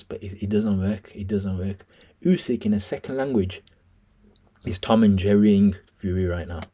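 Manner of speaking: ordinary delivery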